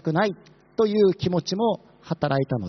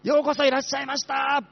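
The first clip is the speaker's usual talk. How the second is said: possessed woman shouting a welcome; 7 semitones higher than usual. Pretend. Possessed woman at the entrance hurrying worshippers inside, shouting. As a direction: bellow the greeting like a woman gripped by a spirit. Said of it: "悪霊に疲れた人が立って".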